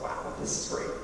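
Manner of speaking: put-on whispered childish delight; hushed wow of a kid about bacon; excited whisper. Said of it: "It was just like".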